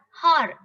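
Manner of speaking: "har" for hour with an h; H sounded. Shown as a demonstration. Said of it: "It is not har".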